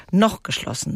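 emphasis landing on "noch"; stress on NOCH. Shown as a demonstration. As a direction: lean on the word "noch", leaving "geschlossen" unstressed. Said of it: "Die Betonung, Saskia, liegt auf".

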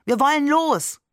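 put-on high, pushy child voice; a kid itching to get going; impatient child. Impatient child urging to leave.

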